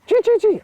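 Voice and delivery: high, loud imitated rat squeaks; dying rat squeal. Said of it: "he makes a noise".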